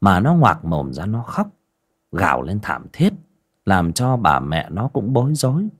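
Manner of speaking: plain reading voice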